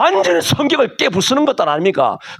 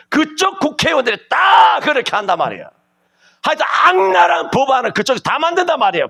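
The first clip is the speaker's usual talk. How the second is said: preaching shout; 7 semitones higher than usual. Real, not acted. Preacher to congregation, denouncing lawmakers in a shout. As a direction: rail at them from the pulpit. Preacher railing against politicians.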